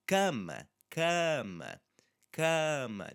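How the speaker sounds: cama with a long, loud first syllable; cama, falling from stressed CA. Shown as a demonstration. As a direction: lean on CA in cama, let the last A shrink. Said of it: "it's still an open sound".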